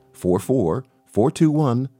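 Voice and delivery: ordinary talk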